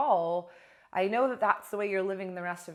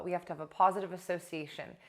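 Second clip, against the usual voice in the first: "We have to have a positive association" rattled off fast and light; quick recap; 4 dB quieter, faster than usual. Shown as a demonstration. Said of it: "is we have to have a positive".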